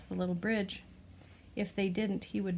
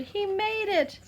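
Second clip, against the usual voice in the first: cheering crowd; 12 semitones higher, 4 dB louder, slower than usual. Acted.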